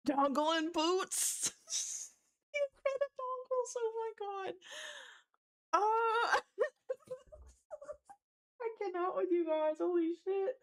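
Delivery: high, wheezing words between bursts of laughter; laughing so hard. Real, not acted. Streamer losing it at chat's jokes, laughing so hard. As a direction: lose it completely, voice squeaking upward. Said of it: "laughed so hard".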